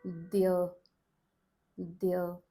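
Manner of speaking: "their" said slowly, with a clear dental start; their sound demo. Shown as a demonstration. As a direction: pronounce "their" carefully for the learner. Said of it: "The and ya combine to form".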